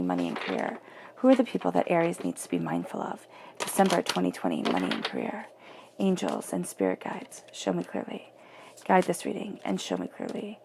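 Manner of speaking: low, soft repeated chant to the guides; hushed, creaky-low card-reader's prayer; murmured invocation. Acted. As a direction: recite it quietly to yourself like a prayer. Murmured invocation over the cards.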